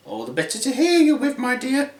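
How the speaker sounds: high fake granny voice; wolf posing as granny. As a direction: answer in a put-on high pitch.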